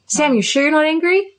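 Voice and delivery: high, leading question; insistent probing. Acted. Therapist pestering Sam about hidden anger, insistent probing.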